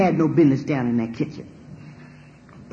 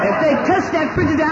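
laughing mock threat; 12 semitones higher, 5 dB louder than usual. Laughing threat about the fridge.

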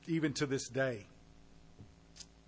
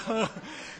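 laughter; 6 semitones higher than usual. Real, not acted. Laugh after smoking story.

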